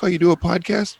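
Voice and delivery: high-pitched imitation of a curious coworker; curious surprise. Retelling curious coworker's question.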